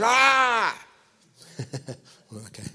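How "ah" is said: loud, high, drawn-out roar; wild cheering scream. Acted. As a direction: roar like a crowd going wild. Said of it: "They're like".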